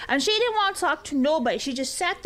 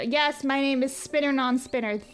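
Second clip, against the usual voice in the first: ordinary delivery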